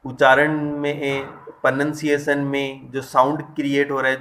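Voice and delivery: ordinary delivery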